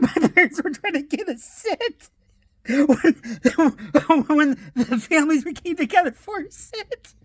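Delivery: high, squeezed words through real laughter; helpless laughter. Real, not acted. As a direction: fight to finish the story while cracking up. Cracks up at his punchline.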